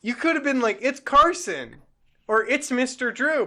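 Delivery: mock frustration; exasperated. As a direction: plead with a dim friend. Exasperated retort at Don.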